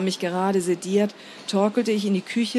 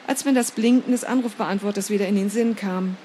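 plain reading voice

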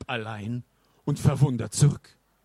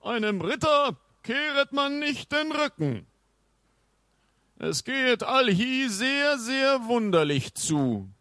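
indignant knight; 10 semitones higher than usual. A narrator's reading. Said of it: "stand entrüstet da und murmelte".

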